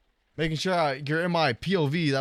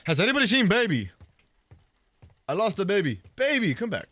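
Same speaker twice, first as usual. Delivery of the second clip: put-on raised, high call for a missing baby; 5 semitones higher, 4 dB louder, faster than usual. Babysitter who lost the baby, calling out. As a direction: call out loudly, searching.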